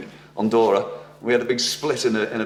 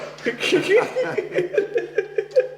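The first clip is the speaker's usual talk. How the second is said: high nervous giggle; nervous laugh. Real, not acted. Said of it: "That's just nervousness".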